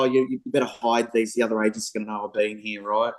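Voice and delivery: a hushed, quick joking warning to the clients; mock-secret tip-off, hushed and hurried; conspiratorial aside. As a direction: slip it to them like a cheeky secret, quick and hushed. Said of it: "I'd always say to them, go".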